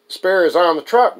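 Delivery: loud, high, drawn-out answer; called-out reply. Acted. Friend answers question about the spare, calling out. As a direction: toss the answer back loudly.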